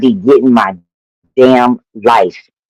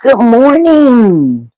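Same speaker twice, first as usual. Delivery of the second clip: drawn-out warm greeting; 9 semitones higher, much slower than usual. Real, not acted.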